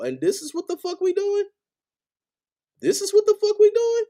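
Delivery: high-pitched, exasperated questioning; incredulous outrage. Real, not acted. Fan ranting about Monday Night Football loss, high incredulous outrage. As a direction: voice shoots up high in disbelief.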